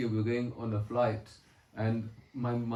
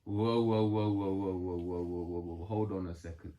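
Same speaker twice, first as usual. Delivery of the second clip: low, soothing words to halt an attacker; calming whoa; 7 semitones lower than usual. Retold or imitated. Vasudev soothing Kamsa before he strikes, low and calming. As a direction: settle a raging man down gently.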